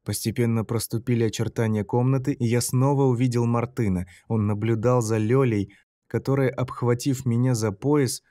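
usual speaking voice

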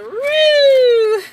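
high, loud siren-like "woo"; high loud siren cry. Retold or imitated. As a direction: wail it like a warning siren. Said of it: "lets off the sirens and be like".

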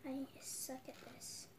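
slow self-mocking whisper. Real, not acted. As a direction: whisper it, a little defeated. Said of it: "I kind of wanted to do a little bit of A S M R".

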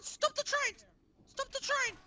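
ordinary delivery

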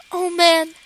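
ordinary delivery

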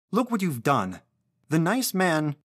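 high, flustered reproach; scolding mother. A narrator's reading.

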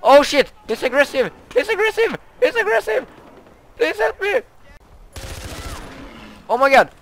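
high, loud panicked screams; panicked screaming. Real, not acted. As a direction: flee in terror. Aggressive animal chasing.

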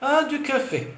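a beaming mother's exclamation. A narrator's reading. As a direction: greet the coffee with pure delight. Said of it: "s'exclama d'un air rayonnant".

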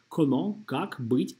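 normal talking voice

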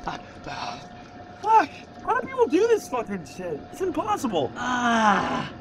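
high strained whimpering cries; cold shock whimpering. Real, not acted. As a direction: whimper from the icy water. In icy cold plunge.

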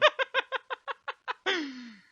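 yüksek ve tiz bir kahkaha patlaması. Real, not acted. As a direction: kendini tutamayan sesli gülüş. Kör üstüne kör yiyince gülme. (art arda kör yiyince patlayan kahkaha)

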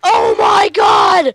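high, loud, drawn-out scream; terrified shout. Real, not acted. Player attacked in game, terrified slow shout. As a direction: shriek it in panic.

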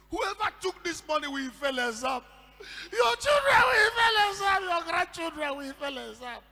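anguished curse. Retold or imitated. Robbed teacher curses her class, crying and shouting. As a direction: wail it out as a wronged teacher's curse. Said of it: "out of pain, she said".

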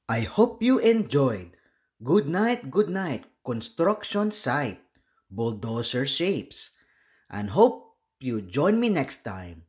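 plain reading voice

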